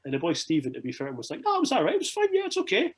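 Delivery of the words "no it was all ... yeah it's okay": imitating a colleague in a very high voice; high-pitched reassurance. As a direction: squeak out an over-eager reassurance. Retold crew member reassuring him after interview.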